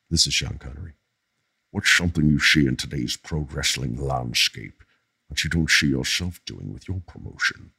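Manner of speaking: low, soft Scottish impression with slushy S sounds; Sean Connery. Host reading a fan's question, as a Sean Connery impression. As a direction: turn every S to "sh", low and measured. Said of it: "it's such a good impression".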